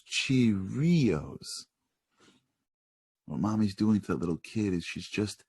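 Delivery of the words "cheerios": CHEERIOS slowly stretched out; mommy modeling. Acted. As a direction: model CHEERIOS for a child, drawing out each syllable. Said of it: "Mommy goes".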